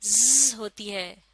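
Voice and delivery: ज़ drawn out slowly as a voiced buzz; slow z demo; buzzed z sound. Shown as a demonstration. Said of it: "तो ये sound".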